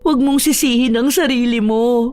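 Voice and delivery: high, pained voice, holding back tears; distressed. Acted. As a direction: comfort the boy while fighting back grief. Grieving owner reassures, questions boy. (mataas at naiiyak na boses)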